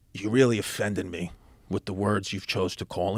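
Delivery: soft, low, restrained imitation of dad; quietly offended. Dad to Brother Rob behind locked door, quietly offended. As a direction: hold the offence in, low and measured. Dad's controlled anger at the principal.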